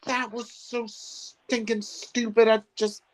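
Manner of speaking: infuriated. Real, not acted. Viewer fuming over stupid writing in the episode, exasperated. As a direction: vent real frustration, drawing the words out. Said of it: "God, infuriates me".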